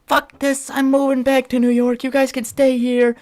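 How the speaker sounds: high, loud shrieking mock of mom; mocking mom's outburst. Son imitating his mother's car-breakdown tantrum, high mock shriek. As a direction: squeal it like a frantic mother losing it. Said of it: "she was like".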